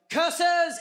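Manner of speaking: high, loud, hammed-up cry to the heavens; yelled curse. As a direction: yell it like a thwarted cartoon villain. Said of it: "and just yelled".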